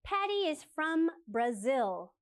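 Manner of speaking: spoken slowly for learners to copy; slow model answer. Shown as a demonstration. English teacher reads the answer slowly for the class. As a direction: drill it slowly and clearly. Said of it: "what are the questions for these answers".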